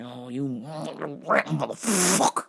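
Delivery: very drawn-out, strangled curse; mock seething rage. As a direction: stretch the curse slowly through a choked throat.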